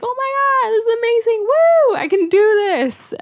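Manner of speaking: high, loud euphoric woo, retold; overjoyed cheering. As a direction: relive the thrill of good news with a cheer. Thrilled at four centimeters.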